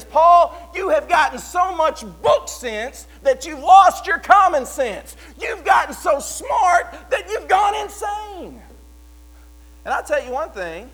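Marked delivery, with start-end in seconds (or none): 0.0-8.6 high, much louder shouted accusation; outraged shout. Retold or imitated. Festus yelling at Paul he's gone mad, high furious shout. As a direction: bellow it like a furious governor. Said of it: "what Festus is basically saying is".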